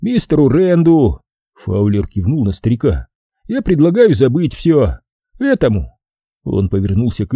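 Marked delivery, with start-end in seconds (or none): 0.0-1.2 Fowler the boss. Acted. 3.5-6.0 Fowler the boss. Acted.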